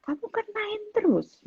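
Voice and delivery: high mock-indignant mother; playful imitation of a protesting mum; retorting mum. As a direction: push back at the kid, pitched well up. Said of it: "Terus saya bilang".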